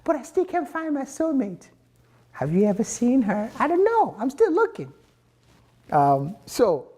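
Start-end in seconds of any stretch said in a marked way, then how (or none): 0.1-4.9 much higher, pleading mock whine; whiny lonely seeker. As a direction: play a desperate single waiting at the station, voice pitched way up. Voices seeker again after dating sites.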